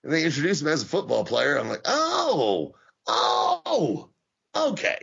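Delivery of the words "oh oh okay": high, drawn-out oh okay; squeaky stunned okay; mock surprise. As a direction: act baffled at the name. Mock surprised reaction to name.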